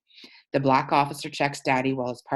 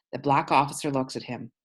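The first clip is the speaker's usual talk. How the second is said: ordinary delivery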